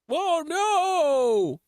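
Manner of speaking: high, alarmed "whoa, no"; sudden squeal of alarm; startled cry. Real, not acted. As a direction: yelp as things go wrong. Character about to die.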